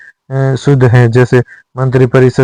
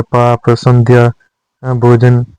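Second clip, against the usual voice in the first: ordinary talk, nothing marked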